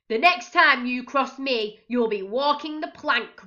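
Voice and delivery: loud threatening roar; roaring captain. A narrator's reading. Raging captain threatens Pete, loud roar. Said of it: "roared the captain".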